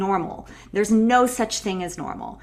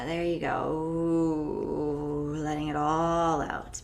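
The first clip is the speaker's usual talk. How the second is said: soothing; 5 semitones lower, 6 dB quieter, much slower than usual. Shown as a demonstration. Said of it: "guiding after the sigh".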